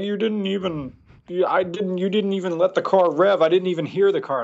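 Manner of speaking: high, whiny complaint; mock upset viewer; whiny complaining fan. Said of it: "people get upset, like".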